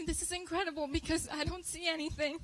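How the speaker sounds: fighting back tears on the good news; getting emotional. Real, not acted. Telling the church about the doctor's call, choked with emotion. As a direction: voice rising, welling up with tears. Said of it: "I'm about to get emotional".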